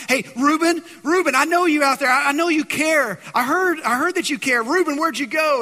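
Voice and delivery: high, loud calling out from a pit; pleading yell. Retold or imitated. Joseph calls up from the pit to his brother, pleading shout. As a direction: shout up to your brother, begging. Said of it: "he's yelling and he's pleading".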